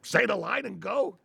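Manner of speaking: high-pitched irritated mock yell, slowing on the end; exasperated yelling director. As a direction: scold the actor for ruining the take. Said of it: "He comes with another yelling".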